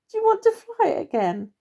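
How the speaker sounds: high, teasing squirrel voice; cheeky. Acted. Young squirrel teasing the Major, cheeky and high. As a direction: tease him with a grin in the voice. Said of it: "he said cheekily".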